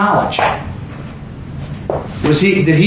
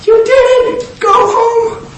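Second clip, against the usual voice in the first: elated shout; slower than usual. Retold or imitated. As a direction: burst out in delight at her faith. Jesus voiced elated again.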